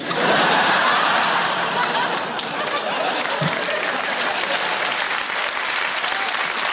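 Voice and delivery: long high giggling shriek, out of control; breathless squealing laughter while tickled; shrieking tickle laugh. Pretend. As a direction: shriek as if being tickled hard.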